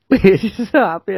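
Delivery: laughing it out, voice lifted high. Real, not acted. Laughing to her co-host about the happy gig night. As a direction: giggle through the words, delighted.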